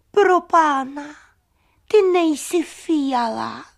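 loud, high cry of alarm; startled. Acted. Sun discovering the flower is no violet, startled loud cry. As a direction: exclaim in shocked surprise, drawing out the words. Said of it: "a leklo se".

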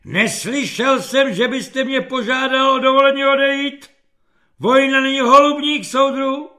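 high, loud military bellow; general's roar. A narrator's reading. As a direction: roar at the soldier to call him back. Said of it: "generálův řev ho přivolal zpátky". (řvát jako generál)

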